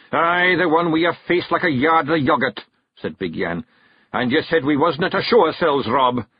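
a touch of a grumbling big Feegle; Big Yan Feegle. Acted.

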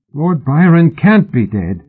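high, slow, emphatic denial; bursting out. A narrator's reading. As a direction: burst out in disbelief. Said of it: "Brian burst out".

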